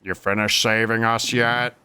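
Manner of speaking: put-on grumpy old man voice. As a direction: creak it like a cranky old man. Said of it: "I gave him old man voice".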